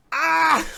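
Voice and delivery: high, loud "ah!" yell; mock war cry. Game master voicing tiny Elkhorn with axe, high mock yell. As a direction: let out a short high battle yelp.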